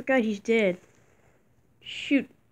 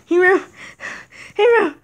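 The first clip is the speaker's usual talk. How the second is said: loud, high calls to the fallen; calling out; 4 semitones higher, 5 dB louder than usual. Acted. Friend calling out to the dead Hero loudly. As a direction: cry the name out, desperate.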